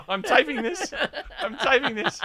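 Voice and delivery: high mock talking-doll voice through laughter; little woman's voice. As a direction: play along as a squeaky pull-string doll. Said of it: "this little woman's voice".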